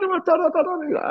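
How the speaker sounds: very high squealed nonsense syllables; high-pitched imitated jabber; mimicked excited babble. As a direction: squeal a mock stream of excitement.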